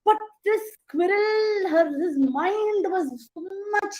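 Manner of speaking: ordinary delivery, nothing marked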